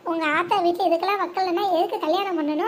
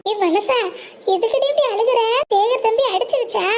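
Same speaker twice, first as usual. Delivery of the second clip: worried questioning; 3 semitones higher, 4 dB louder than usual. Acted. Alarmed questioning of crying friend.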